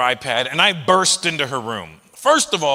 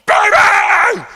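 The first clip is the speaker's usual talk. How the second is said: very high, loud joyful shout; excited yell; 12 dB louder than usual. Retold or imitated. Spazzy dad bursting into daughter's room, very high loud yell. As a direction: burst in and holler at her. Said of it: "I burst in her room and I go".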